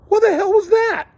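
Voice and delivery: high, loud, stunned what-the-hell; shocked outburst. Retold or imitated. Retelling own shocked reaction to Damon.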